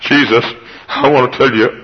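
normal talking voice

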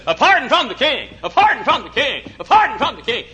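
high, loud theatrical proclamation; operetta performer. Retold or imitated. Operetta man in knickers announcing a pardon, mock theatrical cry. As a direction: imitate a stage herald, each repeat the same tune. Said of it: "this guy who came out in knickers, and he said".

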